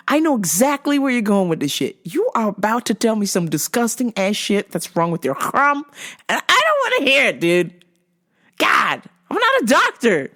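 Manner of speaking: retold exasperation; exasperated rant. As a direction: protest as if fed up, pitch climbing. Acted exasperated inner rant.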